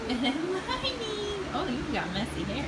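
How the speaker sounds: high, singsong morning greeting. Real, not acted. Mother greeting her child on waking, sweetly.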